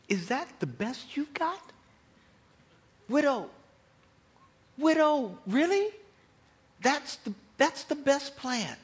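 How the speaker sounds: high-pitched disbelief; mock-incredulous, voice lifted. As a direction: question it as if badly let down. Imagined Elijah doubting God's plan.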